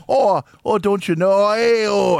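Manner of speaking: a bad attempt at a Wisconsin New York accent, shouting. Joking about how a Wisconsin New York cop sounds, hammed-up Wisconsin New York accent. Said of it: "I can't do any of it".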